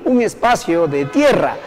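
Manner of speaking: normal talking voice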